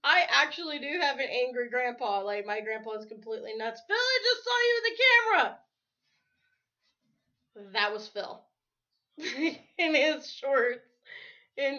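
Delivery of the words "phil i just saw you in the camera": loud, high-pitched yell; calling out. Real, not acted. Vlogger spots Phil on camera, real high shout. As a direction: shout it out at someone across the room.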